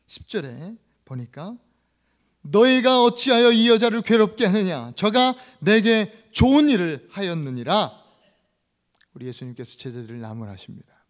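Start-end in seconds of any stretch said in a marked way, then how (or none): 2.4-8.0 loud, solemn scriptural rebuke; Jesus. Acted. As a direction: declaim the reproof with slowing emphasis. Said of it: "제자들을 책망하십니다". (힘주어 책망하는 낭독)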